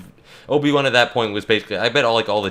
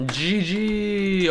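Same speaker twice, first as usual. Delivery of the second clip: triumphant call; 10 semitones higher than usual. Real, not acted. Winning game callout.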